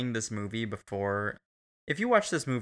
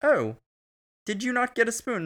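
lightly acted waiter, pitched high; high, louder questioning waiter quote; 12 semitones higher, 5 dB louder than usual. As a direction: play a waiter asking in a high voice. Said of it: "the waiter comes over and he's like".

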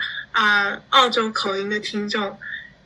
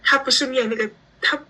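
ordinary delivery

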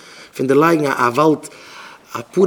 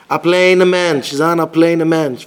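PLAIN stretched out loud and slow; drawn-out emphasis; 6 dB louder, much slower than usual. Real, not acted. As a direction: lean long and hard on PLAIN. Emphasises being a simple person. (לאנג אויסגעצויגן PLAIN)

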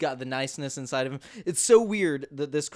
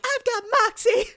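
very high squeaky voice; squeaky defiant reply; 5 dB louder than usual. Retold or imitated. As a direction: chirp it back proudly. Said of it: "she's like".

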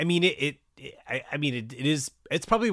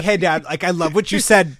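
much louder and higher praise; mock eager Dawson; 6 semitones higher, 10 dB louder than usual. Dawson praising his dad's speech, loud and eager. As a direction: gush before suggesting changes.

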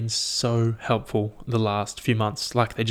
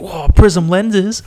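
loud, high, drawn-out whoa; over-the-top excited dog voice; hammed-up dog amazement; 9 semitones higher, 13 dB louder, much slower than usual. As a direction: voice an awestruck puppy, very loud and high. Voices puppy thrilled by sunglasses.

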